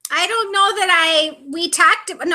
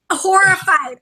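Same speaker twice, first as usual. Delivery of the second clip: shocked gasp; 2 semitones higher than usual. Retold or imitated. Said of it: "And I'm like".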